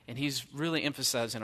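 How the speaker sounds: ordinary talk, nothing marked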